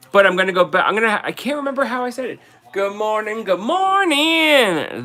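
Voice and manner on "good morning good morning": drawn-out "good morning, good morning", second one much higher and slow; Jersey accented greeting. Shown as a demonstration. Host to viewers, showing how she used to greet them. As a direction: stretch the second "morning" high and long, Jersey style. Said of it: "That's how I said it".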